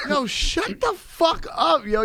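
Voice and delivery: high mock disbelief. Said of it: "I'm like".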